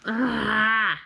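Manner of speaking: long wailing "aah"; frustrated groan. Real, not acted. Reader wishing for another ending, frustrated groan. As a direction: groan out the exasperation. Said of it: "so mad".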